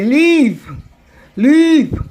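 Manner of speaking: "leave" stretched out loud and long; overlong, drawn-out "leave"; too slow. Shown as a demonstration. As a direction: drag the vowel of "leave" as if it had two beats. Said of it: "You're going too slow".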